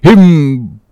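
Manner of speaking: slow, high, halting delivery; faltering for a word. Acted. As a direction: grope for the word, dragging it out. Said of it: "faltering hard for a word".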